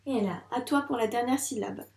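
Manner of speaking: ordinary delivery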